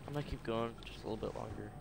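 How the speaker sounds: usual speaking voice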